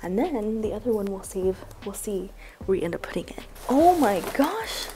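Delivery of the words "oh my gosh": surprised gasp. Real, not acted. Gifts keep coming.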